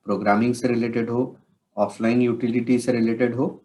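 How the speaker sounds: ordinary talk, nothing marked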